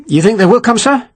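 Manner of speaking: high-pitched, uneasy question; worried, rising-pitched query; apprehensive. A narrator's reading. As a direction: ask uneasily, pitch climbing to the end. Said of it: "Reed asked apprehensively".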